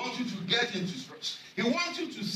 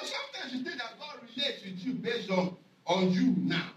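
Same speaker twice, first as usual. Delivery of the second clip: usual speaking voice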